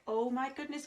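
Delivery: usual speaking voice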